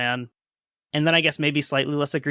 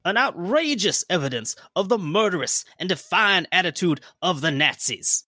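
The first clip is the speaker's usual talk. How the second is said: mock Southern accent, pitched a bit higher, senator's speech; generic Southern congressman; 4 semitones higher than usual. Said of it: "that was my generic Southern congressman".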